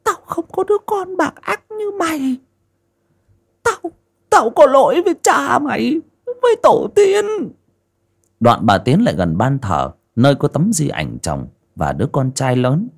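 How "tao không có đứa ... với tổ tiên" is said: put-on high, stern old woman's voice; mother rejecting her son, high and stern. A narrator's reading. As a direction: disown him coldly.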